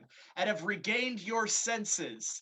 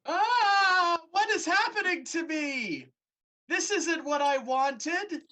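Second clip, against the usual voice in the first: shrill-free but high, frightened wail; terrified dryad crying out; high panicked cry; 9 semitones higher, 7 dB louder than usual. Acted. Dryad panics as fur grows.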